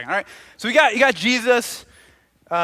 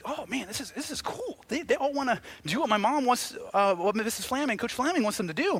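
put-on soft, higher-pitched kid voice; little Johnny; 3 semitones higher, 7 dB quieter than usual. New student watches the other kids, soft childlike voice. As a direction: wonder aloud quietly like a small boy. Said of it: "little Johnny, he's like".

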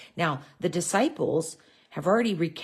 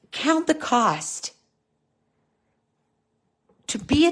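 slow, higher preaching cadence with long pauses; 3 semitones higher than usual. Acted. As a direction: drive the point home from the pulpit. Preaching the main point.

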